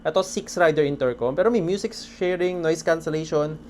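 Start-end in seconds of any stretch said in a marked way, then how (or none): none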